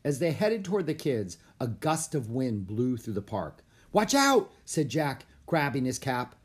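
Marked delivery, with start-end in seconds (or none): 3.9-4.5 loud, high-pitched warning cry; sharp shout of caution; urgent warning. A narrator's reading. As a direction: call out a sudden alarm. Warning as wind gusts.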